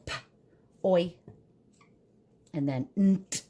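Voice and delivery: "p, oi, nt" piece by piece with long pauses; sounded out. Shown as a demonstration. Teacher spelling point for children, each sound said apart. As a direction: sound out p, then oi, then nt. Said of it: "So I'm gonna go".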